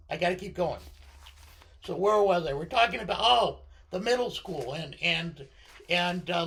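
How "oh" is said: ordinary delivery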